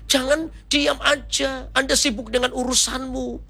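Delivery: high-pitched, fervent preaching; preaching exhortation. Real, not acted. Preacher urges congregation to share the gospel, impassioned preaching.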